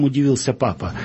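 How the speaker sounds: ordinary delivery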